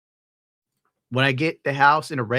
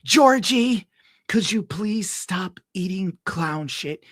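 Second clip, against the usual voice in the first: high, slow imitation of a quirky TV favorite; favorite guy impression; 8 semitones higher, slower than usual. Fan imitating his favorite guy from a dating show, high slow impression. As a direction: imitate him in a drawn-out, high-pitched voice. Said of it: "My favorite guy talks like this".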